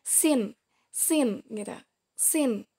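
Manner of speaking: "Sin" with a light S, air flowing out, lips in a slight smile; isolated, careful demo of "Sin"; light Arabic sin. Shown as a demonstration. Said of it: "bibir sedikit tersenyum".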